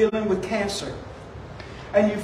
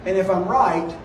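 low, soft, reassuring appeal; gentle explanation; 5 semitones lower, 7 dB quieter than usual. Retold or imitated. Pastor offering to pay for a stranger's cart, gentle. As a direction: softly ask her to let you help.

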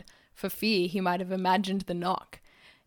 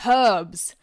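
loud, eager outburst; excited shout; 9 dB louder than usual. Acted. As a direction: blurt it out with triumph. Bucky bursts out with his find.

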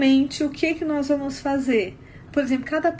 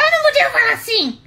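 fast, loud, high-pitched angry yell; angry outburst demo; 10 semitones higher, 5 dB louder, faster than usual. Shown as a demonstration. Woman demonstrating the voice her boyfriend couldn't take, angry yell. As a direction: burst out yelling to show the forbidden tone. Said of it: "Olha como isso faz diferença".

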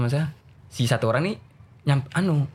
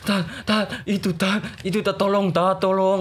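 put-on higher-pitched boy choked with tears; imitated tearful plea; 6 semitones higher than usual. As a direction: beg for help between sobs, acted. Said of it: "nangis sesek tuh".

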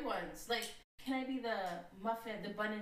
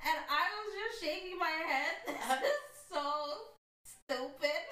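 high, laughing through the words; crying with laughter; 9 semitones higher, 3 dB louder, slower than usual. Real, not acted. Story collapses into laughter.

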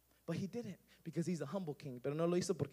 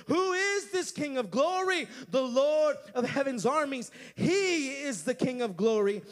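full-force preaching; 6 dB louder than usual. Acted. Preacher proclaiming Psalm 24, full force. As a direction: thunder the psalm to the church.

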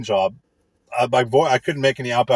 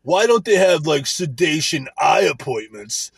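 mock dumb coworker; 4 semitones higher, slower than usual. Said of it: "it's like".

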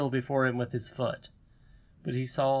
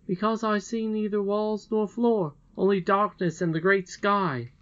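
put-on eager boy Curdie; 8 semitones higher than usual. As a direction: call out like an earnest young miner. Curdie explains what he sees.

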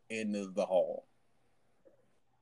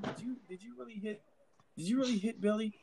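mock parent asking a child what he did; talking to a kid; 11 semitones higher, 6 dB quieter than usual. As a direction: coax it out as if scolding a small boy. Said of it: "it's like talking to a kid".

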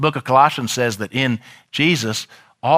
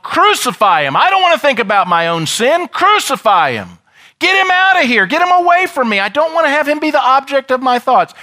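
high, loud shout of an angry crowd; yelled rejection; 11 semitones higher, 5 dB louder than usual. Acted. Preacher voices sinners rejecting Jesus, yelling. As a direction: cry out to drive him away. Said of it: "We yelled".

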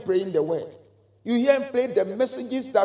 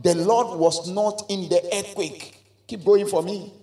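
ordinary delivery

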